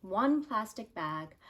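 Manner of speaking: ordinary talk, nothing marked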